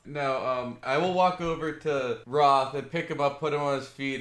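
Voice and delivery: ordinary talk, nothing marked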